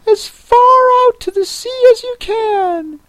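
very high-pitched creature voice; Mock Turtle. A narrator's reading. Mock Turtle explains the lobster quadrille to Alice, very high voice.

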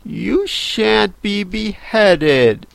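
put-on high, very slow child heroine; Alice, reassuring and firm. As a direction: draw it out in a high girlish voice.

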